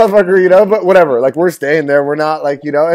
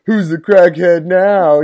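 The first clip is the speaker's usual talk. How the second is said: slow smug taunt; 3 semitones higher, 5 dB louder, much slower than usual. Retold or imitated. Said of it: "he said".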